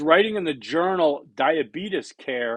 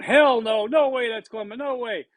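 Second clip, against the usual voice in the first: mock outraged refusal; 8 semitones higher than usual. People answering the meme, mock outraged no. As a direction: mimic people rejecting the chemicals. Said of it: "People were going".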